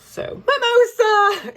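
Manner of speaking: high, drawn-out cheer; excited. Real, not acted. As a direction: sing out the drink's name. Excited announcing drink.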